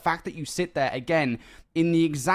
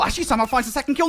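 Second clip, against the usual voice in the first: rising excitement; 9 semitones higher, much faster than usual. Real, not acted. Clutch play builds excitement.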